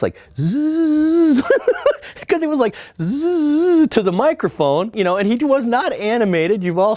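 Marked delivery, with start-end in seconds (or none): 0.4-1.4 high buzzing zzz that glides down; sliding buzz sound; imitated glide. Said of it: "he came out like this". 3.0-3.9 high buzzing zzz that glides down; sliding buzz sound; imitated glide. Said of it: "he came out like this".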